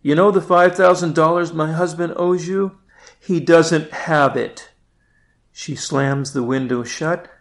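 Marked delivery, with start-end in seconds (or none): 0.0-4.7 louder, higher call to the neighbour; calling across. Acted. Wife calls across to neighbour.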